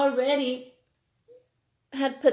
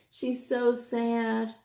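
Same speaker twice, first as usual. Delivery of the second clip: ordinary delivery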